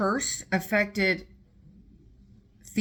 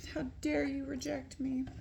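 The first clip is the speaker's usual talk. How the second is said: higher, soft hurt reproach; mock scorned queen; 7 semitones higher, 5 dB quieter than usual. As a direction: ask it like a wounded queen. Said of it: "she was like".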